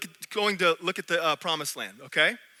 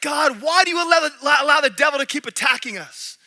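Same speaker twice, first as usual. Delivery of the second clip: higher, louder pleading yell at God; mock yelling; 6 semitones higher, 4 dB louder than usual. Dreamer yelling at God about the devil, higher and louder. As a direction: retell the angry cry at God. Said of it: "I'm, like, yelling at God".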